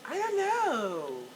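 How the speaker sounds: slow, high mock voice of her daughter; mimicked daughter. Mother imitating daughter's unsure reply, slow and high. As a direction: drag it out high as a clueless daughter.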